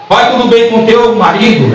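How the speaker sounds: acted messenger asking after someone; questioner. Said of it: "quando Geazi perguntou pra, pra ela".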